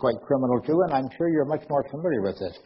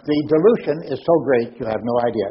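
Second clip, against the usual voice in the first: ordinary talk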